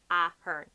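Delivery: AH-hern, loud first syllable in Ahern; stress at start. Shown as a demonstration. Showing viewers where Ahern is emphasised, first syllable. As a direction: hit the A of Ahern, then fall. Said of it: "The emphasis is kind of".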